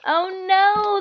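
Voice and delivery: ordinary delivery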